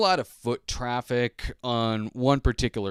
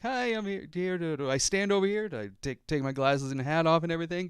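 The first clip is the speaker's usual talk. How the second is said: higher, eager and polite question to the camera clerk; happy voice; 8 semitones higher, 3 dB quieter than usual. Retold or imitated. Asking the camera clerk where to stand, in a happy voice. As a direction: keep it sweet and helpful. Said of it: "speak with a kinda happy voice".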